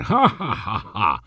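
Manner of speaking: the director's mock-demonic guffaw; demonic laugh. A narrator's reading. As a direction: cackle like a devil. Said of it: "wybuchnął demonicznym śmiechem dyrektor".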